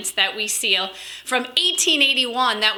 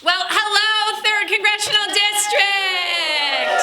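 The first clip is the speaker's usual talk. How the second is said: excited greeting; 7 semitones higher, slower than usual. Real, not acted. Greeting the rally crowd.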